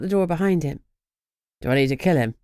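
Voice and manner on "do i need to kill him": a lower voice, asking lightly; light, offhand question in a lower voice; asked lightly. A narrator's reading. Greg asking Lucy lightly, in a lower voice. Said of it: "he asked lightly".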